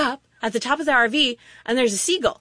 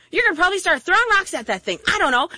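usual speaking voice

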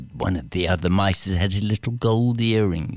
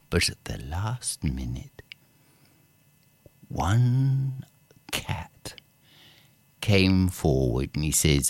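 ordinary delivery

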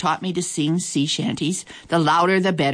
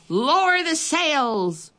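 much higher, very slow order; captain shouting; 10 semitones higher, much slower than usual. A narrator's reading. Braidbeard shouts orders to the crew in the storm. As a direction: call it out over the storm. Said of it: "Braidbeard shouted".

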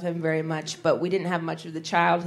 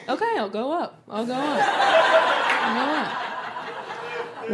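high sing-song retreating dad; awkward basement dad; 6 semitones higher, slower than usual. Pretend. Dad excusing himself from the sleepover, awkward high sing-song. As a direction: sing it out higher, backing away. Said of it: "He'd totally be a basement dad".

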